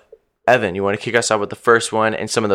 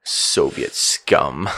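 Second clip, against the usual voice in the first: slow, low, quieter mock sneer; paranoid Murray sneer; 9 semitones lower, 5 dB quieter, much slower than usual. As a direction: drag it out in disgust. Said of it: "He's like".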